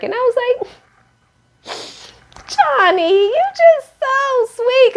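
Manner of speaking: sing-song high aww voice; gushing coo. Retold or imitated. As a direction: melt over how sweet he is. Gushing over Johnny's tearful confession.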